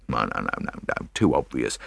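low, soft string of noes; dismissive. A narrator's reading. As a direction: brush the idea aside under your breath. Japp dismisses the idea.

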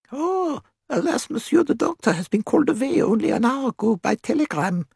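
put-on very high, accented character voice; high-pitched foreign gatekeeper; regretful gatekeeper. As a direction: pipe it up high with polite regret.

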